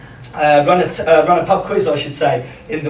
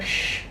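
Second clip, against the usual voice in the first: short hushed burst of static; imitated tannoy hiss; 13 dB quieter than usual. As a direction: mimic a PA crackling on. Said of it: "the best tunnel announcement".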